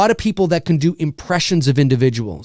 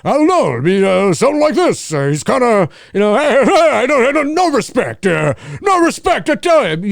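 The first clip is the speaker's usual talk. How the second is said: Rodney Dangerfield; 7 semitones higher, 3 dB louder than usual. Shown as a demonstration. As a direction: complain like a put-upon comic. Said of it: "my impression of Rodney Dangerfield".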